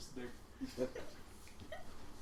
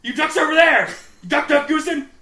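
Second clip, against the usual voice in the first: loud yell at the ducks; shouting and laughing, cursing at ducks; 8 semitones higher, 12 dB louder than usual. Retold or imitated. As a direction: scream it out the boat at the birds. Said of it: "he's like".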